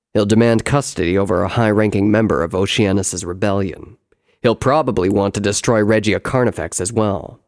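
loud, low brother's voice; deep, forceful elder-brother character; explaining brother. Acted. Brother predicts Gravitas's demands.

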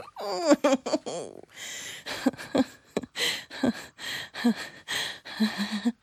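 long, high, loud laugh; real uncontrolled laughter tailing off; giggly laughing fit. Real, not acted. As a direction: burst into helpless high giggles. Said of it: "a very giggly mood".

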